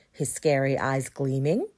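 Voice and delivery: ordinary delivery, nothing marked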